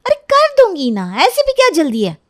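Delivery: high, loud whiny protest; annoyed daughter. Acted. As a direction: put off a nagging mother, exasperated. Daughter argues with scolding mother. (झुंझलाई बेटी की ऊँची आवाज़)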